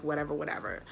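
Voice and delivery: imitated shrug, softly trailing; nonchalant. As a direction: toss it off like lazy, uncaring staff. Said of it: "very nonchalant with me".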